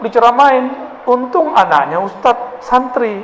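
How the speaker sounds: ordinary talk, nothing marked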